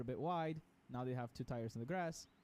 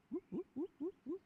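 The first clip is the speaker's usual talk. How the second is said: car bouncing noise. Retold or imitated. Mimicking car going light on crest.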